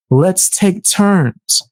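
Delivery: slow, drawn out calm; slow and deliberate. Shown as a demonstration. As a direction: stretch every word out. Very slow demo.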